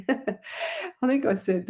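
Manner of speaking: slow, laughing through the words. Real, not acted. Laughing at her own slip of the tongue. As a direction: giggle it out, embarrassed. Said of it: "Oh my God".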